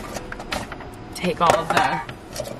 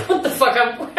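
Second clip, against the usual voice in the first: laughing; 4 semitones higher than usual. Real, not acted. Laughing at her outfit.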